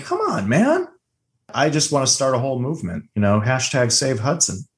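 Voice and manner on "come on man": high-pitched, exasperated appeal; mock protest. Viewer protesting a character left behind, exasperated. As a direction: plead as an exasperated fan.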